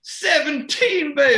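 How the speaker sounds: high, drawn-out triumphant shout; loud, stretched gloating whoop; triumphant cheer. Real, not acted. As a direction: crow over a lucky roll. Player cheers a good dice roll.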